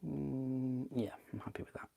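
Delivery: unvoiced whisper to himself. Real, not acted. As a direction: whisper it quietly to yourself. Muttered to himself while working.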